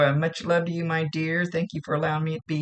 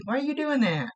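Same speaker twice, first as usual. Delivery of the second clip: higher teasing scold, lightly voiced; playful higher-pitched jab; teasing spirit brother; 9 semitones higher than usual. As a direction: needle her like a cheeky brother. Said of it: "They're always bopping me over the head".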